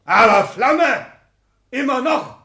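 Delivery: high, loud, slow exultation; defiant pride. Acted. Exzellenz exults to his secretary, high, loud and slow. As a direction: blaze it out triumphantly.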